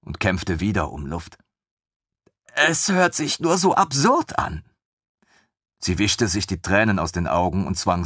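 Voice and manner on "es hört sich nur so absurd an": higher voiced woman, tearful; a woman's higher voice, just after crying. Woman speaking through tears.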